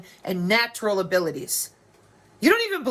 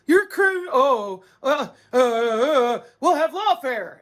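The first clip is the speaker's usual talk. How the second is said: mocking the internet; 3 semitones higher, slower than usual. Mimics internet dismissing her.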